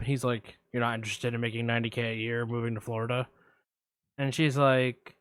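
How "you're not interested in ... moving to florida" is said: lurk low like a shady caller; creepy caller. Retold or imitated. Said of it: "I'm gonna play both parts".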